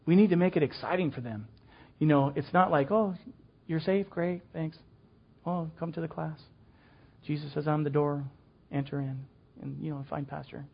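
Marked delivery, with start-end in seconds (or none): 2.9-9.3 mock flat, dull, softer welcome; bored welcome. Teacher doing an unexciting welcome. 9.6-10.7 "find pasture" tossed off soft and flat; dull reading. Shown as a demonstration. Dull version of the verse.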